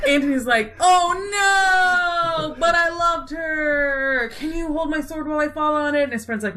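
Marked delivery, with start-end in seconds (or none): 0.8-6.0 hammed-up grief. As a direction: overplay a tragic hero's lament.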